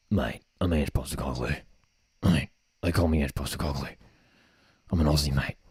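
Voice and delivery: put-on low, gruff Aussie accent; Ange impression. Fan mimicking Ange Postecoglou's Aussie accent.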